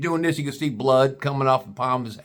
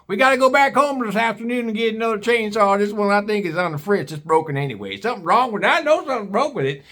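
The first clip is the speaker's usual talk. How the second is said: angry hillbilly father; 6 semitones higher than usual. Retold or imitated. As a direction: play Pappy blaming the chainsaw. Pappy voiced in story.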